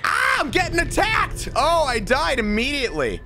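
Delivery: high yelling in panic; panicked shout. Real, not acted. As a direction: scream as you get attacked. Attacked in game.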